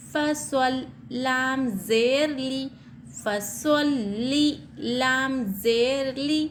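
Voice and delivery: slow, syllable-by-syllable spelling; slow spelling drill. Shown as a demonstration. Teacher spelling Quran words for students, slowly. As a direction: spell each letter and vowel out slowly. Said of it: "spells the verse syllable by syllable".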